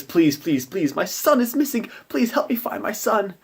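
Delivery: high, pleading mother's voice. Acted. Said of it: "a mother comes up to them and says".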